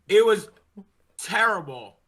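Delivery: usual speaking voice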